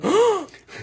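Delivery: loud, high imitated gasp of shock; sharp, shocked mock gasp; audience gasp. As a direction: gasp like a crowd seeing a fall. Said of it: "hear the whole audience go".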